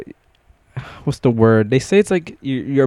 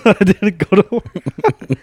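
laughing through it; 7 semitones higher, 6 dB louder, slower than usual. Real, not acted. Laughing while confessing.

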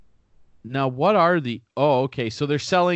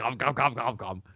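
Cookie Monster voice; 4 dB quieter than usual. Pretend. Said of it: "sounded like the Cookie Monster's".

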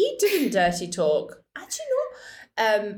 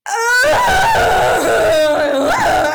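loud, high drawn-out pained wail; loud, high imitation of his pained moaning; mock dying moan; 14 semitones higher, 10 dB louder than usual. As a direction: imitate a dramatic climax groan. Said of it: "it was literally like".

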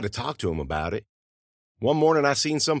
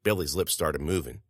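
ordinary delivery, nothing marked

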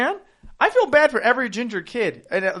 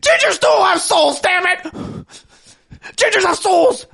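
high, loud impression of an outraged video ranter; mock meltdown, slow and strained high; viral ginger rant; 10 semitones higher, 4 dB louder, slower than usual. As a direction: yell it like a hysterical online rant. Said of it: "The viral ass fucking ginger going".

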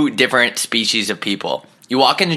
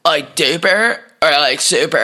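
high, squeaky, slow clerk voice; repeating the clerk's silly choice, high and squeaky; dolphin-like clerk; 6 semitones higher, slower than usual. Retold or imitated. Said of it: "sounded like a dolphin towards the end".

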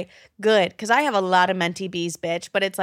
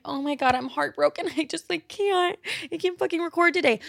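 high, whiny mock sob with a laugh; mock heartbroken whine; 7 semitones higher than usual. Hammed-up heartbroken whine to get out of recording. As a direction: whimper like a drama queen calling in sick. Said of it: "I could have been like".

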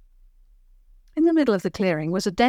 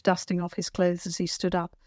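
plain reading voice

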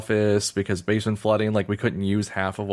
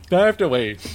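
repeated louder and higher in mock exasperation; a half-joking louder groan of having to wait; exasperated; 8 semitones higher, 5 dB louder than usual. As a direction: throw the line up in comic frustration. Exasperated repeat of own thought.

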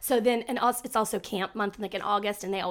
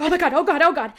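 higher, louder panicked "oh God"; retold panic; 6 semitones higher, 7 dB louder, faster than usual. Mom realizing Zoom heard her, panicked. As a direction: realize in horror you were unmuted. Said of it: "I was like".

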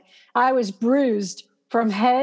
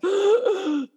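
mask breathing gasp; 6 semitones higher than usual. Retold or imitated. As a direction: suck in air like through a mask. Imitating breathing in oxygen mask.

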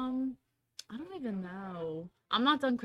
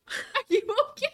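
laughing mock echo; 13 semitones higher, 8 dB louder than usual. Teasing echo of the lady.

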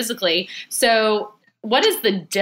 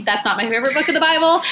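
laughing; 8 semitones higher, 4 dB louder, faster than usual. Real, not acted. Admits disliking Lamentations, laughing.

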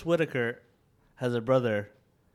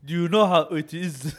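mock African accent; hammed-up African-accented reprimand. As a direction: imitate a scolding official with an African accent. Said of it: "He had an African accent".

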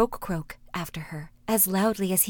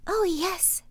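princess voice; 10 semitones higher than usual. Acted. Princess answering the frog: high voice. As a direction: lift the voice up high for the young princess. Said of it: "said she".

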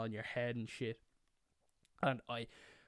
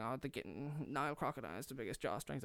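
mumbled, put-on nerd voice; nerdy know-it-all; 5 dB quieter, faster than usual. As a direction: babble trivia like an irritating kid. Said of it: "I was one of those people".

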